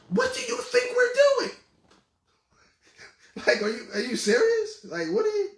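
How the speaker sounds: high, incredulous "what do you think we're doing"; surprised disbelief. Retold or imitated. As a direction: imitate her stunned, rising disbelief. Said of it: "she genuinely looked surprised".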